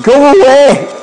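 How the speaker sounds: high, loud cry of a panicking demon; freaking-out evil spirit. Acted. Evil spirit telling Jesus to go away, freaking out. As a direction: shriek it like a spooked evil spirit. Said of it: "hell is freaking out".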